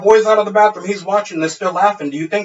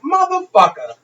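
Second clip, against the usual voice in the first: mock outrage; 11 semitones higher, 4 dB louder than usual. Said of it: "I'm like".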